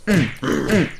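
loud effort grunts; punching grunts. Pretend. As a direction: grunt with each swing. Throwing punches in the game.

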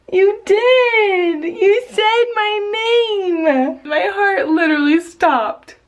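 high, drawn-out, nearly tearful delight; thrilled amazement. Real, not acted. Mother moved that baby said her name, thrilled. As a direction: gush slowly in a high, choked-up voice.

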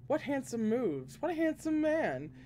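lilting, high-pitched flattery; teasing admiration. A narrator's reading. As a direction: gush at him with a grin. Teasing admiration from grinning character.

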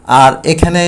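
normal talking voice

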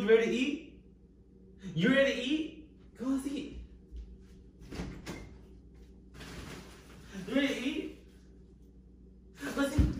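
put-on baby talk to the dog. Calling to the dog.

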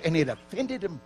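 usual speaking voice

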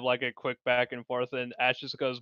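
ordinary delivery

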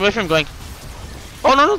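ordinary delivery

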